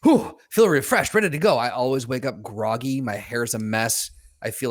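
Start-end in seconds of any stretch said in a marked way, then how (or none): 0.0-1.6 mock refreshed.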